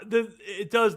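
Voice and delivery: ordinary talk, nothing marked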